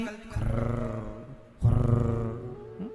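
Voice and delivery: low, drawn-out imitated snore; mock snore; snoring sound. Shown as a demonstration. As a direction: snore slowly through the throat. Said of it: "खर्राटे में कैसी आवाज निकलती".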